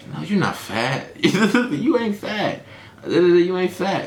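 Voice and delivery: slow, high, laughing reassurance; hammed-up soothing boyfriend; mock reassuring. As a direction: coax her like you don't mean it. Reassuring the girlfriend.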